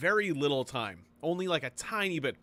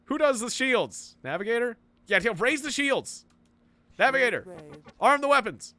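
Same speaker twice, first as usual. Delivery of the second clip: high, loud urgent commands; real panic ordering the crew mid-battle; panicked orders; 9 semitones higher, 6 dB louder than usual. Real, not acted. Panicked orders during space battle.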